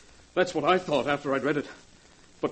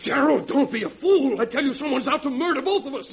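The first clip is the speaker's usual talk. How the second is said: terrified man pleading with his ex-partner; desperate plea; 8 semitones higher, 4 dB louder than usual. Acted. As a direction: beg him to believe you, voice straining high. Desperate man insists on danger.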